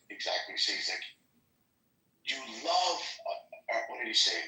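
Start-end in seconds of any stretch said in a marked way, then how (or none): 2.3-3.0 imitating African accent. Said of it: "a bald African guy with this accent".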